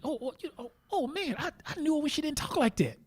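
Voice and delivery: high, teasing mock exclamation. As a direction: pitch it up and needle her. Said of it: "And [name]'s like".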